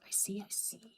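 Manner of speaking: soft whispered aside; whispering. Real, not acted. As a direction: whisper it to yourself. Quiet aside while eating.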